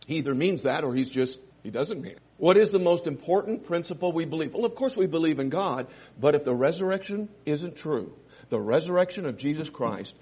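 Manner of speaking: normal talking voice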